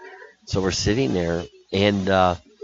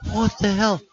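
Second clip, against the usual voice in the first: shocked disbelief; 13 semitones higher, 3 dB louder than usual. Retold or imitated. Shocked at swingers convention.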